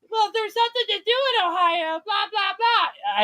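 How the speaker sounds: high squeaky whining Genie voice. Retold or imitated. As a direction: mimic friends in a high whiny voice. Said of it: "I love your Genie voice".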